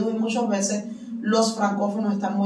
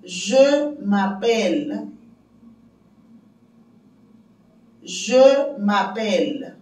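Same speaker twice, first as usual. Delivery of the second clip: very slow, drawn-out French phrase; slow repeated model; slower than usual. Shown as a demonstration. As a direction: repeat slowly for learners to copy. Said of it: "repito antes de terminar".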